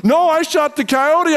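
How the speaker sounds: high-pitched, insistent retold denial; protesting denial. Friend denying to the pastor he shot the dog, protesting. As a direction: insist defensively, pitch jumping up.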